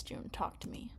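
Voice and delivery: ordinary delivery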